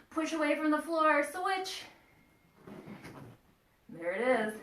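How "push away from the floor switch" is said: high coaching call; urgent high-voiced cue; high-pitched urging. Real, not acted. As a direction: call out the switch in a much higher voice. Coach calling out pose switch.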